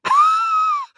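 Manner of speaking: strangled gasp after strong drink; high wheezing gasp. Acted. Greg reacts to the pungent drink, wheezing gasp.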